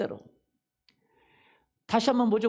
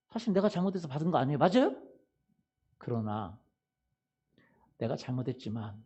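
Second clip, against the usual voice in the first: ordinary talk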